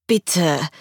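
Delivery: mildly pleading, falling tone; a touch of complaint; plaintive. A narrator's reading. Patient begging the receptionist, mildly plaintive. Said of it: "sagte ich in klagendem Ton".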